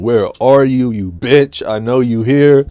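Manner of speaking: imitating Nancy screaming for Kruger; mock scream. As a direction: yell it out across the yard.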